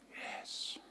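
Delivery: tiny whispered yes. A narrator's reading. Hiding child whispering to the caller. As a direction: barely breathe the word.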